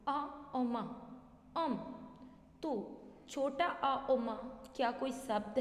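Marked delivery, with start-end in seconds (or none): 0.1-1.1 आ और माँ spelled slowly with short अ, the wrong way; teacher showing the wrong spelling आ और माँ; wrong spelling demo. Shown as a demonstration. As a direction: say आ और माँ as the misspelled अम. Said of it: "जैसे कि हमने बोला".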